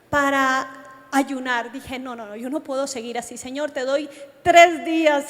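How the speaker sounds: ordinary delivery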